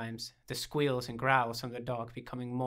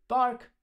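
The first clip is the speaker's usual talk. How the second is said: dog bark; 12 semitones higher, 7 dB louder than usual. Acted. Dog barks at the door.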